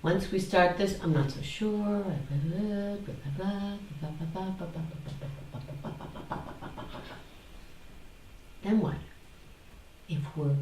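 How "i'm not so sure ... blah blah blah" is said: a mock-uncertain blah blah that trails off into a whisper; fading babble. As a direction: let the babble die away.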